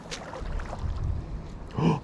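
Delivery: startled gasp. Real, not acted. Fish strikes the bait suddenly.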